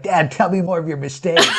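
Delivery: lightly voiced child, laughing; laughing kid voice. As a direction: quote the son's bedtime request through laughter. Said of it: "at night, he'd be like".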